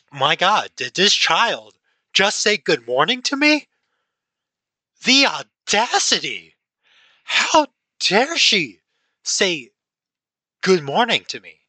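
put-on high, loud, outraged old lady; mock the indignant grandma, drawing out the audacity; annoyed grandma. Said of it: "she looks super annoyed".

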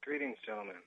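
deadpan machine voice; robot voice. Acted. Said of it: "I feel the need to speak like a robot".

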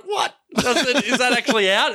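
high-pitched incredulous reaction; retold surprise. Telling how he heard the film was out, surprised. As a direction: imitate your own astonishment, pitch shooting up. Retelling his surprise at release news.

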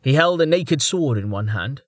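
ordinary delivery, nothing marked

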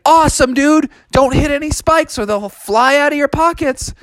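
high, upbeat cartoon dude; Sonic. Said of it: "And Sonic was like".

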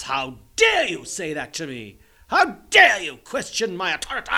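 hammed-up indignant yell; overblown, loud mock indignation; mock outrage. Mock outrage at own imagined question.

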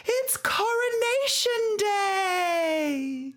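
high, slow, sing-song princess voice; Anna from Frozen. Retold or imitated. Host comparing herself to Anna, high drawn-out princess gush. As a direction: stretch it out like a thrilled cartoon princess. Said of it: "I'm like Anna on Frozen".

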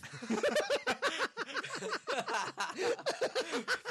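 shrieking laugh; high burst of laughter. Real, not acted. Host cracking up at the guest's attempt.

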